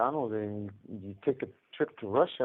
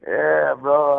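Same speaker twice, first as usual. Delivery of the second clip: laughing; 9 semitones higher, 11 dB louder than usual. Real, not acted. Joking envy, laughing.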